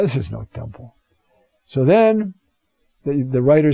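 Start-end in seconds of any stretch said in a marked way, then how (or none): 0.0-0.9 put-on low, soft old man's voice; a lightly voiced old man, grumbling; old-timer's lament. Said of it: "But the old-timer".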